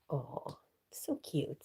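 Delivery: slow whisper over a cute pet; a slow, awed whisper to the cat; whispered cooing. Real, not acted. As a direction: breathe it out, slowly and tenderly.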